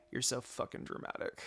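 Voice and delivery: soft, breathy exasperated aside; muttered inner sass. Acted. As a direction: roll your eyes as you say it. Inner Makoto eye-rolling.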